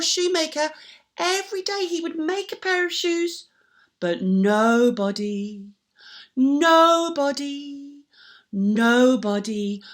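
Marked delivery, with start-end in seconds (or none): 4.0-9.8 slow nobody, leaning on it; slow emphatic nobody. Acted. Storyteller to children, slow mournful nobody. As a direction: drag out nobody sadly. Sad point stretched.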